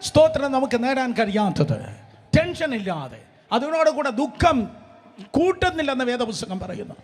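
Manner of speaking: loud, high preaching at full force; ringing pulpit proclamation; fiery preaching. Acted.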